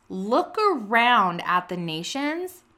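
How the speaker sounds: slow, weighty reading of God's words; slow reverent reading of the Lord's reply; slow solemn scripture read. Shown as a demonstration. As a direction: read it slow and grave, as God speaking. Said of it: "And he says".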